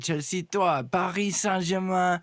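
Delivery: mock French announcer accent, slow; slow, lightly hammed French accent. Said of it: "I started it off with".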